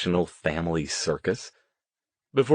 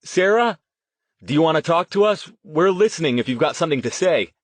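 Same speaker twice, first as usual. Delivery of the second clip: high, quick summons; calling out; 8 semitones higher, 5 dB louder, faster than usual. A narrator's reading. Riley inviting the ghosts to talk, calling out. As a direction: call out, inviting someone unseen to reply. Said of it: "Riley called".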